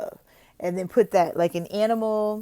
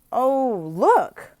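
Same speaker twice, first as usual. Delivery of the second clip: bright, loud oh look; excited; 5 semitones higher, 6 dB louder than usual. Shown as a demonstration. Teacher shows how to excite the student about a picture. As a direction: draw the child in with delight. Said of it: "So you look".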